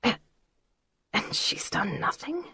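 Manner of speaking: slow, shocked unvoiced whisper. A narrator's reading. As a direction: whisper it slowly, stunned. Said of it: "I whispered".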